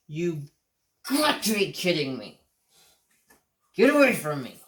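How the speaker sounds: yelling at the dog in disgust; angry. Retold or imitated. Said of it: "I was pissed".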